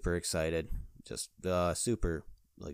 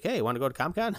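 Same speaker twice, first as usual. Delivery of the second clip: higher, louder imitation of an eager wife; eager invitation; 8 semitones higher, 5 dB louder, faster than usual. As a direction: pitch it up as a keen suggestion. Voices wife's eager invitation.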